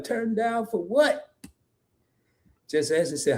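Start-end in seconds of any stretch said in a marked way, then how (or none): 0.0-1.2 a light, slow, drawn-out party-chant imitation. Host likening a comment to a party song, lightly imitating the hook. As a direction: stretch it out like the club hook. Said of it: "It sound like [name]".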